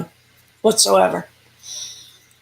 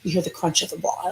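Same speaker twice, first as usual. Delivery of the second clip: normal talking voice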